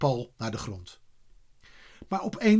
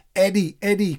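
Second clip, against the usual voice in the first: raised, louder double call; 6 semitones higher, 5 dB louder than usual. A narrator's reading. Boys keep calling Eddy back, calling out. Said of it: "klonk het weer".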